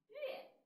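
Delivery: 乐 said as yuè, falling fourth tone; pronounce the character. Shown as a demonstration. Teacher shows pupils how to say 乐 as yuè. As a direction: give 乐 a clear yuè, fourth tone. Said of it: "第一个字念".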